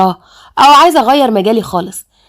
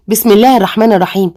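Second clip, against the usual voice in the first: ordinary delivery, nothing marked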